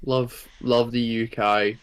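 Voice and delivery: lightly put-on British bloke voice; slow, dry British bloke chant; British bloke accent. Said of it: "Oi, Governor Cameron, give me more of your thoughts".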